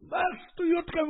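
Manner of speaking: very high, cracking mock yell; a high squealing scold; imitated shout. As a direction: screech it like a man scolding in the mikveh. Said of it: "הוא צעק עליו".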